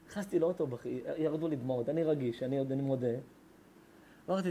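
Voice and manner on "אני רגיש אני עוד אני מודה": ordinary talk, nothing marked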